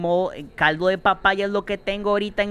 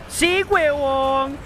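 triumphant cheer again; 9 semitones higher, much slower than usual. Real, not acted. Gamer repeating his survival cheer, slow triumphant yell.

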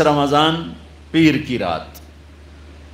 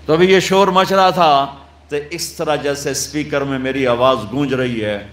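ordinary delivery

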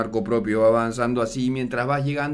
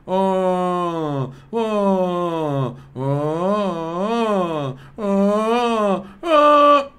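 high, sustained "oh"s ending louder and higher; wordless commentary; 6 semitones higher, 5 dB louder, much slower than usual. Shown as a demonstration. Showing the story without words, held rising "oh"s. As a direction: tell the play with sung-out held vowels only. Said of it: "te cuenta una historia con su voz".

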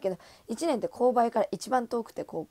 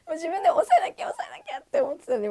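laughing while talking; 6 dB louder than usual. Real, not acted. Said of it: "laughing telling".

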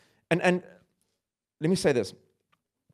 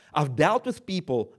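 usual speaking voice

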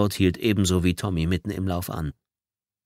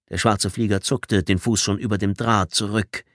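usual speaking voice

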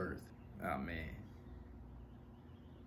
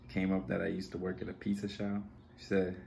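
ordinary talk

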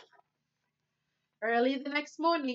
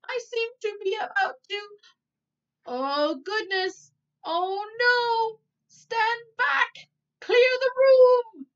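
rising, higher and louder alarm, slowing at the end; a mother in a panic, shouting warnings; panicked cry; 10 semitones higher, 9 dB louder, slower than usual. A narrator's reading. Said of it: "cried Missus Sweeting".

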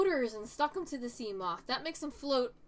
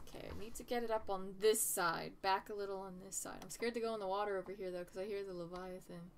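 hushed nervous; 5 semitones lower, 9 dB quieter, faster than usual. Real, not acted. Afraid of Leviathan nearby.

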